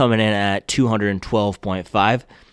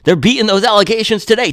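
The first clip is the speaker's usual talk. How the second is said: much higher, louder swaggering brand voice; mock Hoka boast; 12 semitones higher, 6 dB louder than usual. Hoka bragging to critics about its quality control, high mock boast. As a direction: brag like the company is showing off. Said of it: "Hoka said".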